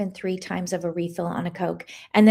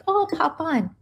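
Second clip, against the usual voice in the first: breezy self-quote; 7 semitones higher than usual. Retold or imitated. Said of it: "and I'm like".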